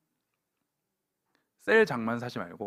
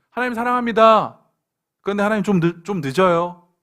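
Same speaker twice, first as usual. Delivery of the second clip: loud, hammed-up excuse, louder on the confession; mock believer's excuse; 5 semitones higher, 10 dB louder than usual. A believer confessing love to God, then excusing lateness, hammed-up and loud. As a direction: play the believer who loves God but is late.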